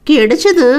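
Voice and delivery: put-on subdued young woman's reply; unenthusiastic. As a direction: admit the news without any joy. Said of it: "என்றாள் குரலில் சிறுத்தை இல்லாமல்".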